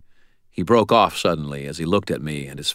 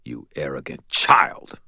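slow whispered snap; snapped whisper; 3 semitones lower, slower than usual. A narrator's reading. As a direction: hiss it in cold anger. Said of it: "he snapped".

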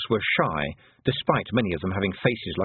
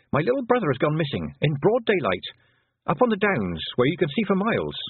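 urgent; 7 semitones higher than usual. A narrator's reading. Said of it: "snapped Tiffany".

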